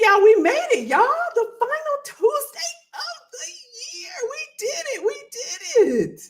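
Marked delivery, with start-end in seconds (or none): 1.3-6.2 high cheering shout with a cry in it; cheering the audience on the final Tuesday, joyful shout; excited shout. Real, not acted. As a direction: whoop it out, celebrating the last Tuesday of the year. Celebrating last Tuesday of year.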